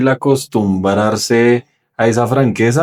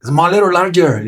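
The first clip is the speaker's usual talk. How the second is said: hurried and brusque; 8 semitones higher, much slower than usual. Retold or imitated. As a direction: rush it out like an annoyed cashier. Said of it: "así como todo afanado".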